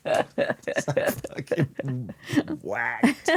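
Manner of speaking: ordinary talk, nothing marked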